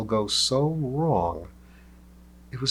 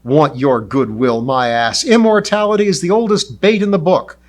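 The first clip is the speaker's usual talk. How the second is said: loud, higher, defiant shouting; furious suspicious yell; angry retort; 4 semitones higher, 10 dB louder than usual. Acted. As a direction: spit out distrust loudly.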